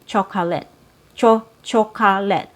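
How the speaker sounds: "chocolate" as CHO-kah-let, three full syllables; every vowel sounded fully in "chocolate"; over-sounded chocolate. Shown as a demonstration. Teacher showing what natives don't say, "chocolate" as CHO-kah-let. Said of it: "you're not gonna hear native speakers say".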